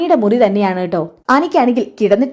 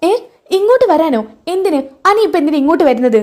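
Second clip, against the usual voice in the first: alarmed disbelief; 6 semitones higher than usual. Acted. Nandu alarmed Anil will come.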